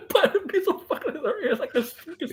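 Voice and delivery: high laughing talk; words break into laughter. Real, not acted. Laughing about a wild video idea.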